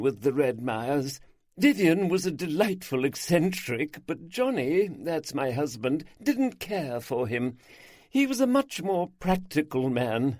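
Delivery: a hint of a lively older lady; lightly voiced gossiping hostess. A narrator's reading. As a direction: gossip cheerfully about the neighbours. Isabel speaking in the story.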